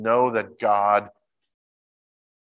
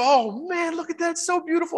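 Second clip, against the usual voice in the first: higher, gushing mock awe; imitating awestruck man. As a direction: marvel at the rainbow like the video guy. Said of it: "He goes".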